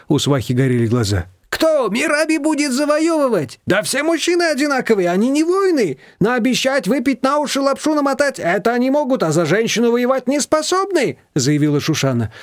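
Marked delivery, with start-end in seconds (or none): 1.5-11.2 very high-pitched, scornful tirade; indignant outburst. A narrator's reading. Shushana scoffing that men cannot win women, indignant. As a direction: rant in a high, outraged voice.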